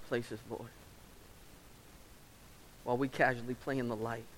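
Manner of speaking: earnest pastor's prayer, measured and steady; closing prayer. Acted. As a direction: pray over the congregation.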